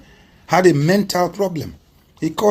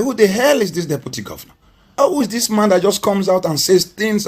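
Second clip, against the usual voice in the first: mock indignant, raised pitch; retold angry outburst; 6 semitones higher than usual. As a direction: imitate a furious governor demanding who this man is. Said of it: "he, he was angry".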